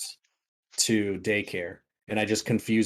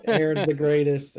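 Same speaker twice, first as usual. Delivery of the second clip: mock cheer; 6 semitones higher, 5 dB louder than usual. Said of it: "they were like".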